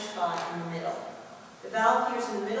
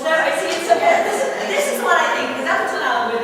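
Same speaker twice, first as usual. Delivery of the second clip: loud burst; 6 semitones higher, 10 dB louder, much faster than usual. Real, not acted. Teacher suddenly much louder and faster.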